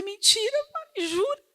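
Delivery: high teasing ironic voice; mock sympathy. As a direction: exaggerate a fake gasp of pity. Mother mocks daughter's drama. (falsa pena, em tom alto e irônico)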